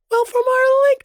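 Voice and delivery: a sudden falsetto break; accidental squeak. Real, not acted. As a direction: voice cracks way up into a loud squeak. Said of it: "I do not know how I did that".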